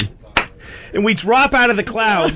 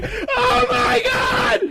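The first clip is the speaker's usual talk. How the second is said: mock wail, much higher and slow; hammed-up sobbing scream; imitating screaming sobbing; 3 dB quieter, much slower than usual. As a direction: wail it like a terrified mother on a crashing plane. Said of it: "screaming and sobbing".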